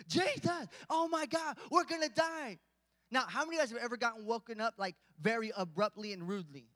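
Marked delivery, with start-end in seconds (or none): 0.1-2.6 high-pitched mock panic; shriek-like hammed-up alarm to wake a sleeper; crazy panicking disciple. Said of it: "some crazy disciple says".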